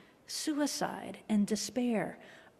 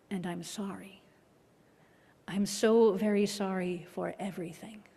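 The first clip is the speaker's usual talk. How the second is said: whispered apology. Retold or imitated. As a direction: breathe the apology without voice.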